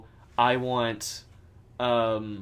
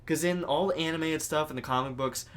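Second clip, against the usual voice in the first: ordinary talk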